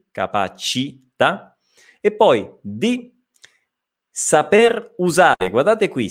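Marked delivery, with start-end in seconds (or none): 0.1-1.6 "capacità" slowly, the C as a soft "ch"; slow, separated "ca-pa-ci-tà" with a clear ch; careful C sound. Shown as a demonstration. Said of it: "nuovamente la C". 4.1-5.5 "saper usare" slowly, a clear R closing "saper"; slow, deliberate "saper usare" with the R sounded; highlighted final R. Shown as a demonstration. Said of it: "perché ho evidenziato questa R".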